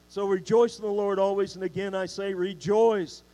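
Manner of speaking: loud, joyful "rejoice"; preacher shows emphatic rejoicing. Shown as a demonstration. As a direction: proclaim it with no holding back. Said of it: "No holding back".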